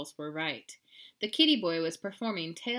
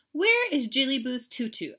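mildly exasperated, louder and higher; questioning mama; 6 semitones higher, 6 dB louder than usual. A narrator's reading. Mama asks the girls about tutu, louder questioning. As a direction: ask it like a puzzled mother.

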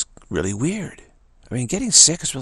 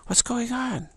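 high-pitched mock shock; 12 semitones higher, slower than usual. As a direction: exclaim it in playful disbelief, pitch way up. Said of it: "I'm, like, shocked. I say".

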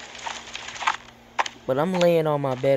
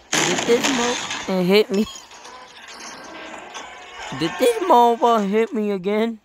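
incredulous; 8 semitones higher, 4 dB louder than usual. Acted. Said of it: "You're like".

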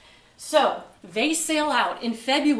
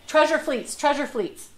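louder, higher repeated prompt; voiced emphatic hint; 4 semitones higher, 6 dB louder than usual. Lecturer repeating her hint mid-story, loud and emphatic. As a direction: say it out loud, punched.

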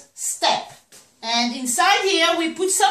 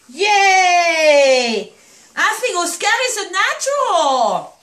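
loud yay into high-pitched praise; delighted cheer; 4 semitones higher, 4 dB louder, slower than usual. Real, not acted. Owner cheering puppy Oscar as a natural, delighted cheer.

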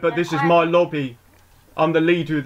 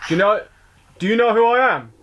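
mock tough challenge; 5 semitones higher, 3 dB louder than usual. Roadman persona confronts another player.

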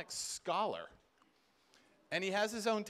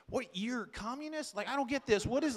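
higher, quick baffled Wes voice; imitating Wes; 6 semitones higher than usual. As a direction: fire it back, baffled. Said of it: "Wes talks like this".

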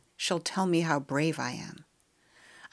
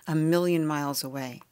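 usual speaking voice

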